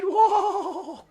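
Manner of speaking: high, loud, wavering whoa; long whoop at a big trick; amazed "whoa". Real, not acted. Reacts to rotation live.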